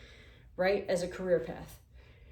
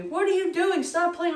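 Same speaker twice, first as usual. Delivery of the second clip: much higher yelling voice; mock manager yelling; 9 semitones higher, 4 dB louder than usual. Manager yelling at her to stop playing. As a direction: yell across the aisle like an annoyed boss. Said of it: "my manager yelling at me".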